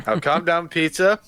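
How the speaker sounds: a mock stern calm-down; Brian Blessed voice. Blessed holding Peter in a headlock, mock stern. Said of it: "he's like".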